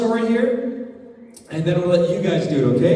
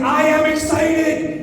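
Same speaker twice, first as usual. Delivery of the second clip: excited; 7 semitones higher than usual. Shown as a demonstration. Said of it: "No, I'm excited".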